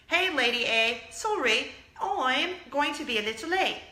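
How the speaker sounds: mock British accent. Wannabe British lady texting she'll be late, mock British accent. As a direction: put on a bad British accent. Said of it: "Not that you would text in".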